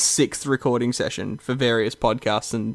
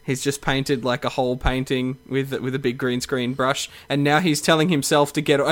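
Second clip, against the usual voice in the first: ordinary talk, nothing marked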